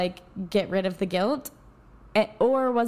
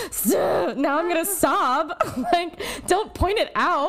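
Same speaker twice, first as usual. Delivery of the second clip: imitating herself at therapy; 6 semitones higher, slower than usual. Client pleading with her therapist, a laughing mock whine. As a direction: whine at him like you're about to cry, half joking. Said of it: "And I'd be like".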